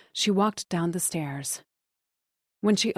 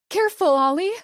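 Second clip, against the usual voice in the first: high, loud cry through the window; wife shouting to her husband through the window; shouted; 11 semitones higher, 8 dB louder, faster than usual. A narrator's reading. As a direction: yell a warning through the screen. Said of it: "she shouted through the screen".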